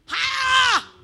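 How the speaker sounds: high, loud drawn-out "Ha!"; sudden loud cry. Real, not acted. Preacher exclaims to the congregation, high loud cry. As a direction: burst out with a piercing "Ha".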